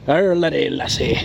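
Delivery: mock pirate accent on "Ireland lassie", slow; lightly hammed pirate accent. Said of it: "why do I sound like a pirate".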